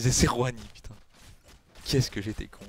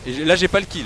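outraged; 7 semitones higher, 9 dB louder than usual. Real, not acted. Kill stolen in game.

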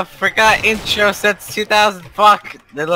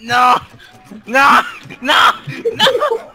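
despairing shouts; 7 semitones higher, slower than usual. Real, not acted. Dying in game, repeated no.

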